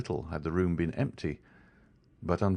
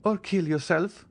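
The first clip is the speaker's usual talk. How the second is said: merciless; 10 semitones higher than usual. A narrator's reading. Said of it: "in a tone which a vestal ... would have used".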